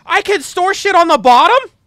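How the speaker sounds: loud, high, drawn-out cry of amazement; astonished shout. Real, not acted. Streamer discovers he can store things on the bottom, astonished shout. Player discovers new storage in game.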